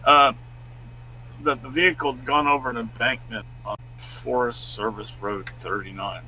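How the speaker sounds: normal talking voice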